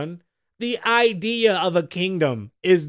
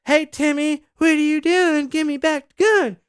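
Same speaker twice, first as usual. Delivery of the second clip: high, sweet teacher voice asking nicely; mock gentle teacher; 9 semitones higher, 3 dB louder than usual. Comic recalling the teacher, in a high, sweet scold. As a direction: play the polite schoolteacher coaxing a kid. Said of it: "Not like".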